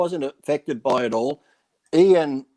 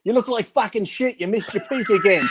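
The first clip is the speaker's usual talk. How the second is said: high, jeering put-down; mock taunting yell; 6 semitones higher than usual. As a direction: sneer it back at him, pitched up. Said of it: "Titus yells back".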